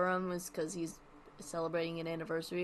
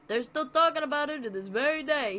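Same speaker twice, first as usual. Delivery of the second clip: higher, louder Brooklyn comedian; Brooklyn [name]; 7 semitones higher, 5 dB louder than usual. Acted. [name] bragging to the host, Brooklyn accent. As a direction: Brooklyn comic brag, punchy. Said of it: "He's turning Brooklyn".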